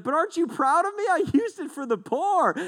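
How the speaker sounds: high, fast, whiny plea for approval; hammed-up self-justifying plea; mock pleading thief. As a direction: play the guilty thief angling for praise. Thief justifies himself, acted.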